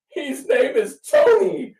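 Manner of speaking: put-on high, slow small child's voice; little girl voice. Retelling the lost girl answering her, in a child's voice.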